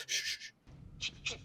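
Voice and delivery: quiet hissed shushes; a quick, hushed string of shushes; shushing. Retold or imitated. Superman shushing Luthor in a retold scene, shushing. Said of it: "Superman actually goes".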